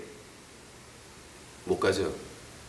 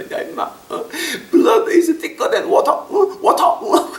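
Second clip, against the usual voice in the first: put-on drunk big brother; much slower than usual. Drunk older brother phoning the pastor, slow high drawl. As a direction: play the drunk brother lecturing over the phone. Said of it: "큰형님이 술 먹고 전화해서".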